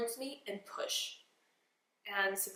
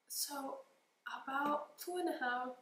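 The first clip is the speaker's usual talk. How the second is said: ordinary talk, nothing marked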